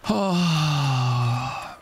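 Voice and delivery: long exhaled sigh, falling away; big real sigh of relief; relieved sigh. Real, not acted. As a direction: let the tension out in one long breath. Relief after winning the fight.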